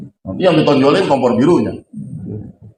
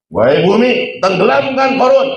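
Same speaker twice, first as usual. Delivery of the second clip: commanding shout; 11 semitones higher, slower than usual. Acted. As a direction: order the ground to swallow him. Musa commands the earth. (perintah lantang kepada bumi)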